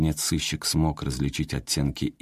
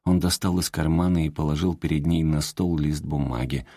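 ordinary delivery, nothing marked

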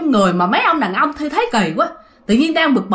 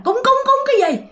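grumpy grandpa yelling; 10 semitones higher than usual. Retold or imitated. Grandpa yelling at grandma about the offering, angry shout. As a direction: snap at the wife over the offering. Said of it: "ông ngoại la làng lên".

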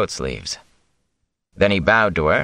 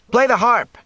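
high, loud call; cry from the crowd; 4 dB louder than usual. A narrator's reading. Villager crying out to the gleeman for the harp, loud.

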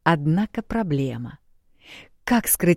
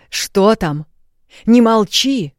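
urgent demand; 5 semitones higher, 6 dB louder, slower than usual. A narrator's reading. King demands answer urgently.

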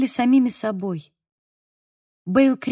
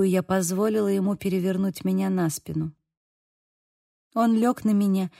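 ordinary delivery

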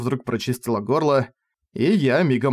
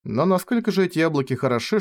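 plain reading voice

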